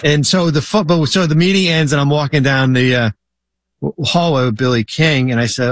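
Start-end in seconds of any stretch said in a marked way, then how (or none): none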